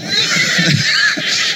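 shrieking burst of laughter; high whooping laugh. Real, not acted. Laughs at own joke.

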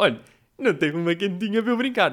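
put-on high, quick, childlike plea; little kid begging. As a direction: ask like a small child wanting a toy. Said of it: "Com tom de, não é tipo".

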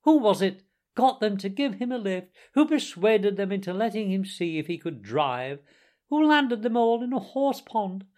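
high, loud, gloating Toad; ecstatic self-admiration. A narrator's reading. Toad praising himself after his escape, ecstatically boastful. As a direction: crow over each triumph, pitched well up. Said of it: "in ecstasies of self-admiration".

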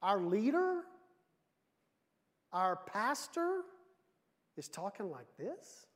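drawn-out, disbelieving questions; slow, puzzled and incredulous; mock dismay. Church reacts in dismay to letter.

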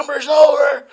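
high, loud mock yell; imitated customer shout. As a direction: bellow it like the old customer. Imitates customer's shout once more.

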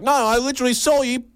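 very high, fast mock accuser; accusing lady. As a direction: rattle off the accusation in a high put-on voice. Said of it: "the lady was like".

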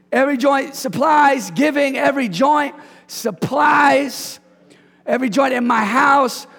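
a pastor driving the verse home to the church; full preaching. Real, not acted. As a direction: preach it, repeating every phrase.

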